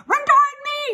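high, loud gander shout; piercing high holler; gander's yell. A narrator's reading. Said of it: "yelled the gander".

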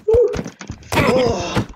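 sudden high startled oh; surprised shout. Real, not acted. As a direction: caught off guard mid-fight. Sudden game moment.

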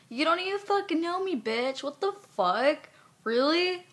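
high-pitched, incredulous anger; indignant retort. Real, not acted. Woman answering girls who judge her unknown, indignant. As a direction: fire back at them in rising indignation.

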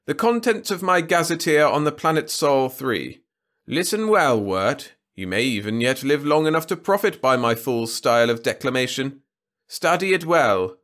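lordly, lofty king voice; haughty ruler. A narrator's reading. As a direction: talk down from the throne. Trescu addressing court.

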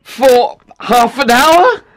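high, slow, worried question; anxious. A narrator's reading. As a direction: ask nervously and slowly. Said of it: "said Octavian anxiously".

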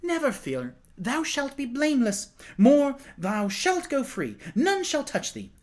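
put-on young king hurrying to reassure the accused woman; excited. A narrator's reading. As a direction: rush to calm her, bright with excitement. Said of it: "he added excitedly".

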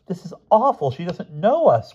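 high-pitched wail of dismay; distressed cry. A narrator's reading. Martha unrecognised by her mother, crying out. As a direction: cry out in horror. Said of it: "cried Martha".

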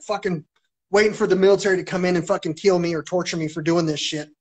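ordinary talk

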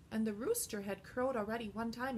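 ordinary talk

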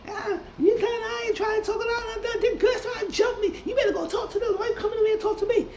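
high falsetto imitation of Nikki, laughing through it; mocking Nikki. Recapping the show, mimicking Nikki brushing off [name] in a high laughing voice. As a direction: squeak it out as a mocked, aggravated Nikki.

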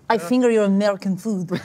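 put-on Russian-accented deadpan line; understated Russian character voice. Russian character jokes while mixing.

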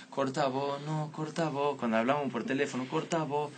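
soft, cooing hang-up banter; sweet lovers' coo. Retold or imitated. Young couple on the phone, softly cooing. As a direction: coo it softly like a lovestruck teen.

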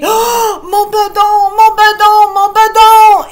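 high, loud moaning yell; bellyache cry. Acted. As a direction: howl in pain holding belly. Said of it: "commence à crier". (cri de douleur aigu et fort)

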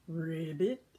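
low ribbit with a laugh; laughing frog. Acted. The frog croaks ribbit with a laugh. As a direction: croak through a giggle.

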